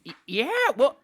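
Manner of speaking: high, stammering goblin; nervous goblin. Acted. Goblin guard questioning the stranger, high and stammering. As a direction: stutter it like a wary drunk goblin.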